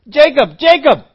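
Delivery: high, loud call of a name. Acted. God calling out to Jacob loudly. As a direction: ring his name out twice.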